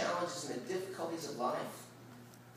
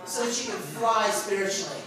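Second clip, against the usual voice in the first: loud, high revival-style preaching; big shouted sermon build; fired-up preaching; 10 semitones higher, 14 dB louder than usual. Acted. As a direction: preach it hard to the retreat crowd. Preaching at full force.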